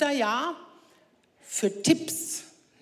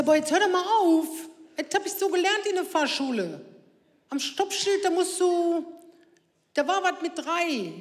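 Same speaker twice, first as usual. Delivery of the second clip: put-on whiny teen son; 6 semitones higher than usual. Son protests to his mother at the stop sign, high and whiny. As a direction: play the indignant teen defending himself. Son quoted snapping back.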